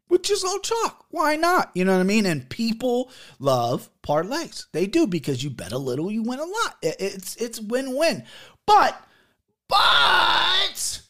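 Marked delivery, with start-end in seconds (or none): 0.1-1.7 high, put-on hype voice; a hammed-up tipster selling his parlay; mock pitch. Mock salesman pitch, himself. 8.6-11.0 mock wail. Dramatic lament over the card.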